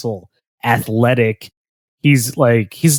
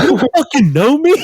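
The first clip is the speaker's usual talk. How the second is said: laughing mock retort; 12 semitones higher than usual. Retelling the brother snapping back at the girl, laughing. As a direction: laugh out the brother's indignant line.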